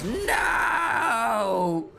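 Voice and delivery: a high, anguished mock "No!"; hammed-up fan cry of "No!"; imagined chat shout. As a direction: wail it like a fan in despair. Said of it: "That's how I imagine they sound".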